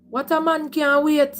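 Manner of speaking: normal talking voice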